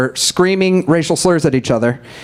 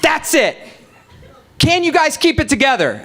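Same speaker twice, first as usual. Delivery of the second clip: high, raised, fed-up scolding; exasperated shout; 11 semitones higher than usual. Retold or imitated. A bus driver scolding fighting passengers again. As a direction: scold them like it's the last warning. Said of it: "the bus driver's like".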